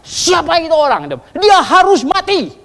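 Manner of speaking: high, loud outraged roar; furious shout. Retold or imitated. King David condemns the rich man in a fury, shouting. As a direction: bellow the verdict like an enraged king. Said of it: "Daud marah besar".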